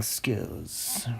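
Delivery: trailing off to a whisper; mock-modest hushed finish. Host boasting of acting skills, ending in a whisper.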